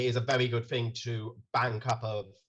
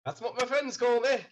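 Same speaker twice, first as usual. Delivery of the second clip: Irish barman; 12 semitones higher than usual. Acted.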